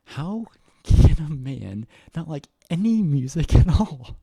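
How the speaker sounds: loud, high exasperated rant. Real, not acted. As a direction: throw up your hands, loud and high. Ranting about the husband.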